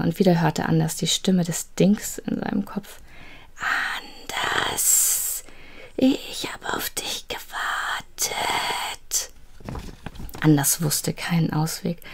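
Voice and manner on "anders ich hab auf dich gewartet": soft, far-off unvoiced call of a name; a hollow whisper from the dark; a faint ghostly voice calling the name; eerie creature whisper. Creature's voice in his head.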